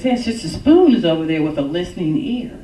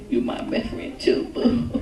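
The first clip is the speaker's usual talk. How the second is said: tender whisper to husband; 10 semitones lower, 6 dB quieter than usual. Retold or imitated.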